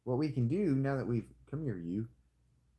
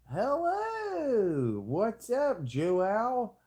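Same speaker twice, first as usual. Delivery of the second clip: mock cheery greeting; 11 semitones higher, 5 dB louder, much slower than usual. Greeting the King of Portugal.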